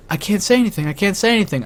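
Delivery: soft, helpless repeated refusal; flustered refusal. Retold or imitated. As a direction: say it small, as if tongue-tied. Acts too flustered to tell friend.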